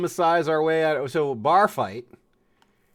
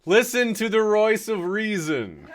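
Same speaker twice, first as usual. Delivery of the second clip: slow, high, louder pompous punchline; hammed-up grand pronouncement; mock proclamation; 9 semitones higher, 6 dB louder than usual. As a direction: declaim the pun like a royal decree. Pun delivered as mock proclamation.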